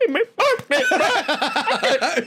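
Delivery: put-on high whining kid voice; child crying for mommy in a high whine; whiny little kid. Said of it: "I was like".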